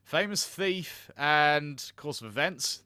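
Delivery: ordinary talk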